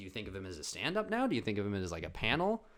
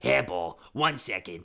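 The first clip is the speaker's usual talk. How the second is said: loud animated cat villain; 7 dB louder, slower than usual. Acted. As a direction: bark it like a cartoon cat baddie. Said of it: "classic impression of a, uh, cat bad guy".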